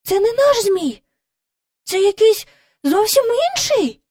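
high, excited cry; alarmed. Acted. Jane realises it is not their kite, high alarmed cry. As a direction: shock at the stranger kite.

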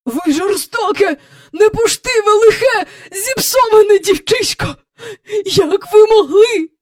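high, near-sobbing cry, slowing at the end; a frightened old woman wailing accusations; shrill tearful outburst. A narrator's reading. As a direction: Miss Andrew wailing at Mary Poppins. Terrified Miss Andrew cries out.

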